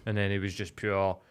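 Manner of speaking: ordinary talk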